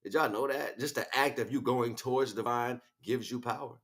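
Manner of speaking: ordinary talk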